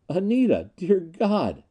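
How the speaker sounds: high cry of loving disbelief; amazed recognition. A narrator's reading. Gregg embraces Anita alive, amazed. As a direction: gasp out her name in wonder.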